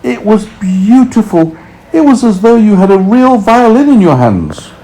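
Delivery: put-on high small boy voice; delighted child's answer; young boy James. A narrator's reading. James answering the grasshopper, high boyish delight.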